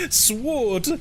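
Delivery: "Sword" with the W sounded, through laughter; pronounced W. Retold or imitated. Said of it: "pronounce the W in sword".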